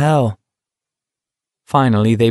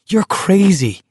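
higher, sharp angry retort; 10 semitones higher than usual. Acted. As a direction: throw it back, rattled. Garrity provoked by taunts.

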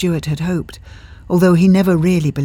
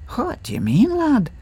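put-on Scottish accent; 11 semitones higher than usual. A narrator's reading. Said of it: "Henry asks, Scottish ghost".

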